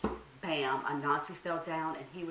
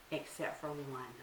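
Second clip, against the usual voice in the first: ordinary delivery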